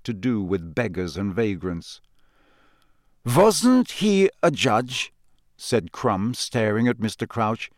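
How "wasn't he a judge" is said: slow, higher-pitched foreign student voice; puzzled question, drawn out slowly; Krum. A narrator's reading. Krum asking about the man staring, slow and puzzled.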